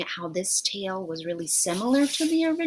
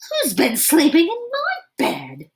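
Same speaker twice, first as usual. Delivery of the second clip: medium-sized shout; 9 semitones higher, 7 dB louder than usual. Acted. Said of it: "shouted Mommy Rhino in her medium-sized voice".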